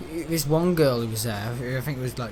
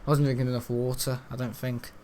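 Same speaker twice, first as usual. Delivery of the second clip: ordinary delivery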